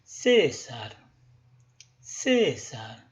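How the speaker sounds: "César" chanted slowly, CÉ high and long, sar low; high-low intonation. Shown as a demonstration. Teacher modelling a rhythm exercise, chanting CÉ high, sar low in "César". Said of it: "Lo decimos una vez más".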